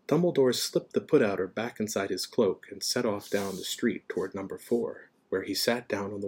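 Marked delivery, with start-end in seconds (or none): none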